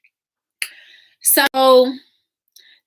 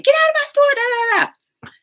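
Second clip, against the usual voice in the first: much higher, louder mimicking of an angry woman; high, loud imitation of a woman ordering someone out; shrill mock yell; 5 dB louder than usual. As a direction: squeal it out like an irate shopkeeper. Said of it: "she was clearly saying".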